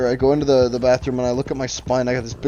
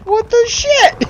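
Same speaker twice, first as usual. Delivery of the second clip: much higher, louder, slow squeal of disbelief; high, stretched-out mock outrage; incredulous outrage; 7 dB louder, slower than usual. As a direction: shriek it like you can't believe the betrayal. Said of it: "I was like".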